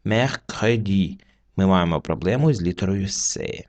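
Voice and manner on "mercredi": slow careful French mercredi; slow French model. Shown as a demonstration. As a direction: say mercredi slowly, each syllable clear. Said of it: "Наступне слово читається".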